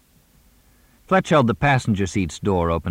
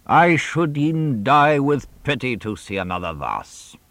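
higher, theatrical Shakespeare recital; Lear quote; 7 semitones higher than usual. Acted. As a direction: intone it like old King Lear on stage.

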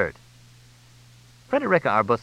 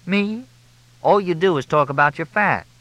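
high, indignant woman's voice; offended, pitched well up; indignant retort; 9 semitones higher, 4 dB louder than usual. A narrator's reading. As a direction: snap back in a high, louder voice. Indignant Crystal retorts to Fletch.

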